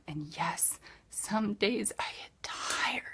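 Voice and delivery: soft, slow whispered confession. Real, not acted. Admitting to her team she gets tired, whispered. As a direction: hush it like a secret.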